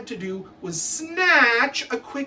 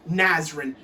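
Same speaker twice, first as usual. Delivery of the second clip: ordinary talk, nothing marked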